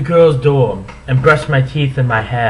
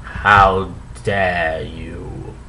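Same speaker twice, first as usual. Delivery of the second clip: stern demand; 8 semitones lower, 4 dB quieter, much slower than usual. Acted. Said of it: "demanded Professor Snape".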